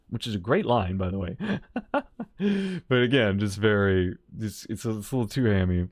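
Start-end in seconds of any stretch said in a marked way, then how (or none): none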